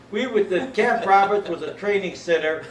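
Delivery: usual speaking voice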